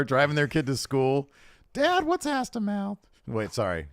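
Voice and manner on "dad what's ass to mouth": put-on high, slow kid voice; adult doing a kid impression; child voice. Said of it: "I gotta do my kid impression".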